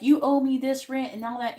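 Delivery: a hint of a spiteful ex demanding rent; petty demand. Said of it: "He's not gonna fight and be petty".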